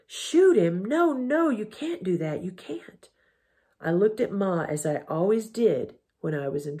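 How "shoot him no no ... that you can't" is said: high, pleading refusal, fading at the end; distressed protest. A narrator's reading. Child protests shooting the calf.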